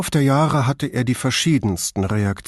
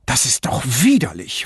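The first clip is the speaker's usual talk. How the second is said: mild, understated hissed disgust; a touch of a snarl in the reading. Acted. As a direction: spit out the disgust lightly. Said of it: "fauchte sie".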